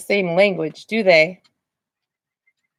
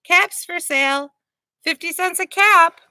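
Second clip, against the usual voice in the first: high, loud street vendor's call; peddler calling wares; 9 semitones higher, 4 dB louder than usual. Acted. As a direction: call out to sell caps. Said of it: "walked back to town calling".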